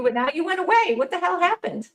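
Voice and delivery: chipmunk-pitched audio glitch; sped-up squeaky chipmunk sound; helium voice. Real, not acted. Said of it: "you sound like a chipmunk".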